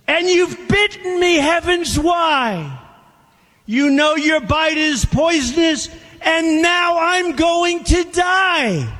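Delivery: woman crying out. Acted. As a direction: cry out in betrayed disbelief. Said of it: "cried the woman".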